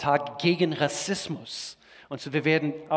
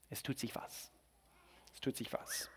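hushed, secretive whisper; 17 dB quieter than usual. Acted. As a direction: lean in and whisper it. Pastor hushes expectantly about building.

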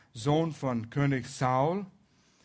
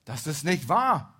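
loud, emphatic denial; insistent protest; 2 semitones higher, 7 dB louder than usual. A narrator's reading. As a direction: object firmly, with raised volume. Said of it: "protestierte Jonathan".

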